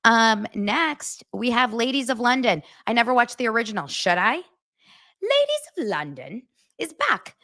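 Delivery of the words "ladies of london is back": Dorit. Acted. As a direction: read it in a grand pseudo-British accent. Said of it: "That was Dorit reading it".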